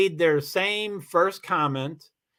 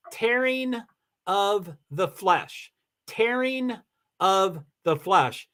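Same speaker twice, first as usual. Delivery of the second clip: very slow, word by word for weight; slow, deliberate; slower than usual. Shown as a demonstration. Streamer explaining what sarcasm means in Greek, slow and deliberate. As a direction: draw out each word and pause between phrases. Dwells on the grim Greek meaning.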